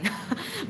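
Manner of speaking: a quick real giggle at her own joke; short high giggle. Real, not acted. Laughing at her own side-quest-queen joke, short giggle. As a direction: let a bright little laugh slip out.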